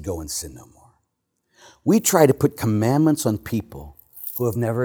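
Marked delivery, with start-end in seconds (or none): 0.0-0.9 "Go and sin no more" said much lower and far softer, almost hushed, "Go" falling gently; soft and gentle. Retold or imitated. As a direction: say it tenderly, as a loving release. Jesus's words, tender retelling.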